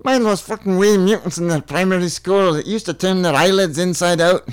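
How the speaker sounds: loud, high Scottish voice; Scottish accent. Acted. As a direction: ask it loudly as an incredulous Scot. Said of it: "You're so from Scotland".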